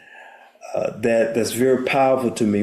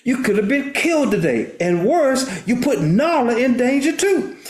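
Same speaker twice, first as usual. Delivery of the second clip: disappointed father scolding; 10 semitones higher than usual. Retold or imitated. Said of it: "Mufasa looks down on him and says".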